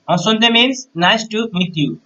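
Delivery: ordinary delivery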